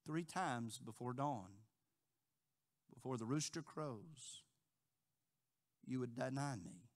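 soft, slow grave voice; quiet solemn prophecy. Acted. Jesus telling Peter his failure, soft and slow. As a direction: tell him gently and gravely. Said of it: "he said".

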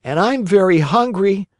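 hungry Pinocchio. A narrator's reading. Pinocchio chiming in after a day's flight, lightly voiced puppet. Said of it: "said Pinocchio".

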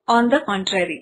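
usual speaking voice

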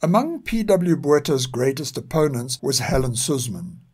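ordinary delivery

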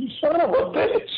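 amused you got me; laughing mock curse. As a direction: curse your friend while cracking up. Realizes he was pranked.

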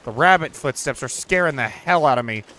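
ordinary talk, nothing marked

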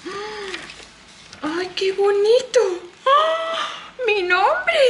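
high, thrilled cry of delight; real delighted surprise. Real, not acted. Finding her own name on the gift, delighted surprise. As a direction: gasp and exclaim in delight.

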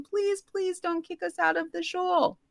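high, soft pleading; high-pitched tearful begging. Retold or imitated. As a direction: plead softly, desperately. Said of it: "And I begged him".

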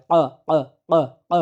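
ক struck from the throat; throat knock. Shown as a demonstration. Teacher drilling the throat hit, clipped throaty bursts. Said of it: "গলাটাকে বাড়ি দিতে হবে".

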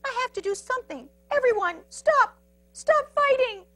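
high little hero's cry; Pipsqueak calling out. A narrator's reading. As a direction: shout high over a brawl to stop it. Pipsqueak pleads to stop fight.

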